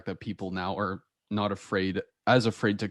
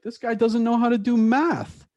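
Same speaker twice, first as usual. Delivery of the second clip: much higher, louder and slow ridicule; mocking teacher; 13 semitones higher, 6 dB louder than usual. Teacher mocking a student before the class, high slow ridicule. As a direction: sneer it to the whole class. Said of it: "she looked to the class and she was like".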